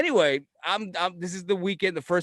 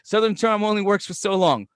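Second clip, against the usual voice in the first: loud, raised-pitch brush-off; mock rude local; 5 semitones higher, 6 dB louder than usual. Florida local done charming the newcomer, mock hostility. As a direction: drop the charm and challenge the customer.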